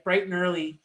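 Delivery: ordinary talk, nothing marked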